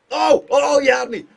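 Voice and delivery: high, loud shocked gasp; loud surprised outburst; startled cry. Real, not acted. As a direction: react as the goalie fools you. Goalie fakes him out.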